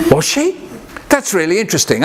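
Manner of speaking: high-pitched, wide-eyed interest; imitating eager curiosity. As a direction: pipe up like a fascinated youngster. Acting his younger self's eager curiosity.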